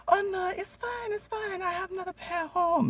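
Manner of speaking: very high, squeaky put-on voice; the boss's wife brushing it off in a falsetto; mock Jenny voice. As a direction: squeak it out like a dainty lady.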